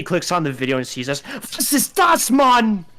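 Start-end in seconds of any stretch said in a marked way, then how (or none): 1.6-2.8 high, loud German exclamation; German shouting. Retold or imitated. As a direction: yelp it like a shocked German. Streamer reacts in German.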